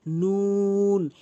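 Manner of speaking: নুন with rounded lips and long oo; rounded, drawn out. Shown as a demonstration. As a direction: round the mouth and hold নুন. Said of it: "মুখটি গোলাকার করে এভাবে". (নুন মুখ গোল করে টেনে)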